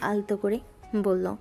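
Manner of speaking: ordinary talk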